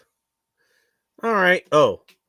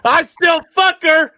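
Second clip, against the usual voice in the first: high mock shout; 11 dB louder than usual. Joking shock line, then kidding.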